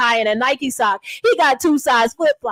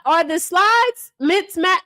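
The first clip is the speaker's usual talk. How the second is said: ordinary delivery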